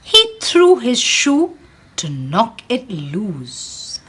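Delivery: lively storybook read-aloud for a class; animated picture-book reading; reading to children. Acted. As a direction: read it like storytime for little ones. Teacher reads picture book aloud.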